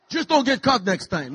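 lightly voiced Greek father accent; a hint of a Greek dad; dad's Greek accent. A hint of his Greek father's accent, advising his son. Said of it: "my dad had a thick accent".

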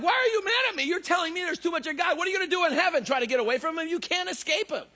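mock-indignant, high-pitched comeback; high, exasperated retort, retold; retold protest. Retelling his argument with old lady.

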